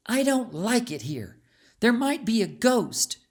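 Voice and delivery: put-on a little girl half-scared of ghosts; a touch of fear. A narrator's reading. Child scared in dark tunnel.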